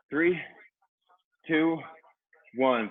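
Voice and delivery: upbeat trainer countdown; fitness instructor countdown. Real, not acted. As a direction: count them in like a coach. Countdown to start interval.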